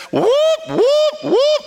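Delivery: high alarm-siren whoops; mock alarm siren. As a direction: sound the inner alarm bells, high and loud. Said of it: "everything in you says".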